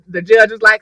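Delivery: usual speaking voice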